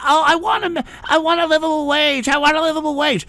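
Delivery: high, whining mockery of complainers; whiny mock quote. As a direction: whine it like a spoiled kid. Mocking complainers about wages.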